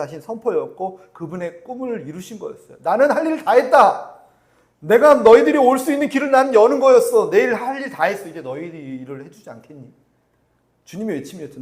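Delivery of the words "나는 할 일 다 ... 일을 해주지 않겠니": high, loud proclaiming of a finished work; Jesus' triumphant cry. Acted. Preacher voicing Jesus calling out to his people, loud triumphant cry. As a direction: cry it out as the Lord declaring victory.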